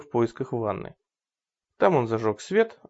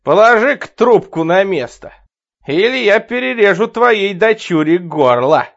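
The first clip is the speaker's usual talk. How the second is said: loud, higher threatening shout; loud call; 7 semitones higher, 11 dB louder than usual. Acted. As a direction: bark the threat loudly. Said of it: "громко позвал он".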